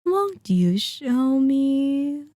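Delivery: slow, high, drawn-out coaxing. Acted. Vampire asking to see the hunter's necklace, coaxing. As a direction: wheedle it sweetly, stretching each word.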